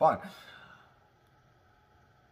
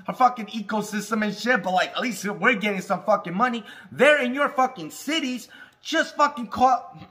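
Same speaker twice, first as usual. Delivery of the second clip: ordinary talk, nothing marked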